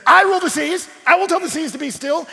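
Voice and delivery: high, loud, grandiose proclamation; mad king's boast. Retold or imitated. Mad king claiming power over the seas, boastful proclamation. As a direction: declare it like a self-glorifying madman. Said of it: "the mad king who said".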